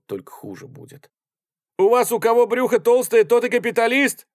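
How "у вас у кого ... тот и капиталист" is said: high, loud outburst; sudden indignant shout. A narrator's reading. Artskhanov suddenly breaks into the argument, shouting indignantly. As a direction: burst in shouting, pitch way up. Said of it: "вдруг выкрикнул он".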